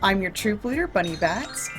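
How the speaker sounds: usual speaking voice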